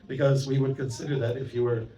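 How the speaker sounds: normal talking voice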